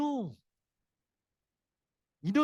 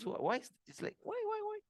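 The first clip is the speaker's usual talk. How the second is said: soft, higher-pitched puzzled imitation; puzzled son; 14 semitones higher, 10 dB quieter than usual. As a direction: quietly mimic a baffled son asking himself. Son baffled by lack of response.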